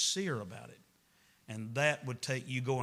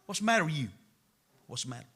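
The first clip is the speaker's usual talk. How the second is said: said fast; much faster than usual. Shown as a demonstration. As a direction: run together quickly. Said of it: "We say it fast".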